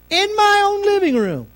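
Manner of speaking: high-pitched outrage at the insult; in my own home, of all places; indignant disbelief. Real, not acted. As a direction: squeak it out in disbelief.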